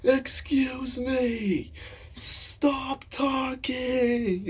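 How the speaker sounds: very high, loud, drawn-out mock rage; raging teacher. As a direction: imitate an old teacher yelling at his class. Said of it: "he was raging at us".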